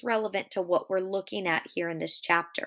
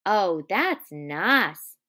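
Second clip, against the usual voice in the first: drawn-out, swooping delight; slow, louder dreamy enjoyment; savouring pleasure; 5 dB louder, much slower than usual. Acted. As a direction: stretch each word out with simple pleasure. Lenny strokes harder, delighted.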